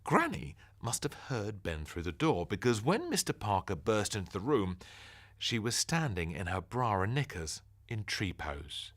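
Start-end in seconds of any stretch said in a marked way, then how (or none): none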